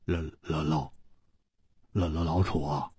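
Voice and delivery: low, very quiet stutter; hushed, halting, struggling to speak; stunned stammer. A narrator's reading. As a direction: stammer the name under your breath in shock. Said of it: "失声说".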